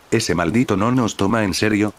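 ordinary delivery

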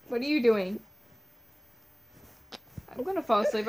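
normal talking voice

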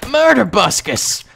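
drawn-out, high, loud catchphrase cry; Tobuscus. Acted. Gamer echoing a YouTuber's catchphrase mid-battle, impersonating. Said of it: "Tobuscus always going".

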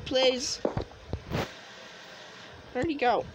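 ordinary delivery

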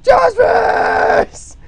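slow then high, loud yell; mock shout. Voicing the drawing with a loud high shout. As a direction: scream it as the doodle. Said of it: "he's like".

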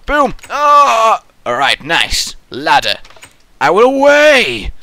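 high, loud hammed-up cheers; mock heroic. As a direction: play a gleeful adventurer. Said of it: "So you're like".

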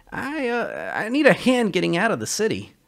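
nervous Sully. Acted.

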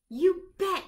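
high, loud eager burst; excited. A narrator's reading. Little Lucy answering her father, excited. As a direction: blurt it out thrilled to finally go. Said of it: "she says".

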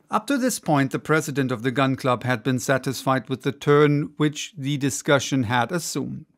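usual speaking voice

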